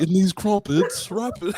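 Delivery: hammed-up British rapper; mock British accent. Host joking about a rapper faking it, mock British accent. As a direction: put on a silly British accent. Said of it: "he was just playing".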